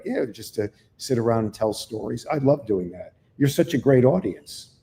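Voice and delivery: normal talking voice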